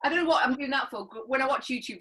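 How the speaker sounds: normal talking voice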